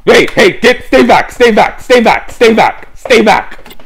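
high, loud panicked yelling; panicked shouting. Acted. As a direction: yell at her to back off. Fending off the vampire.

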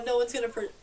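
ordinary delivery

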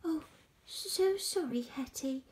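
high, let-down sorry; sighing apology. A narrator's reading. George apologises to Hetty, high with a sigh. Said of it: "said George with a sigh".

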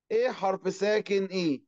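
ordinary talk

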